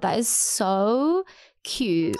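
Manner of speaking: slow, choked-up words through real tears; real teary. Real, not acted. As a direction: let the tears come as you read it. Moved by a listener's review.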